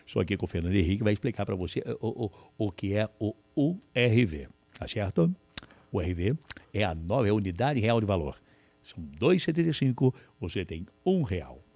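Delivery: a quick host selling the new currency, lightly done; imitating Silvio. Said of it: "eu lembro do Silvio explicando".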